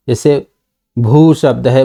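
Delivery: usual speaking voice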